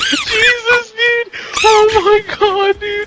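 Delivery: high-pitched cries, nearly breathless with amusement; hysterical exclaiming. Real, not acted. Friend reacting to the stubbed toe act, hysterical exclaiming. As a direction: squeal it out, cracking up.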